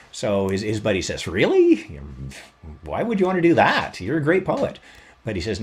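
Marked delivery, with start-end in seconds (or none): 1.3-4.8 higher and louder, amazed disbelief; can't believe what you're hearing; incredulous. Retold or imitated. Buddy hearing Robbie wants to be a taxman, incredulous.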